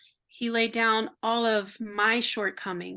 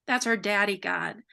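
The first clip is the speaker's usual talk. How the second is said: ordinary delivery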